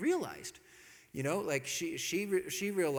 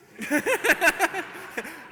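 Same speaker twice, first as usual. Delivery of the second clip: loud high rapid laugh; mocking cackle; 11 semitones higher, 10 dB louder than usual. Married man laughing at the newcomer, mocking cackle. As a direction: laugh right in the friend's face.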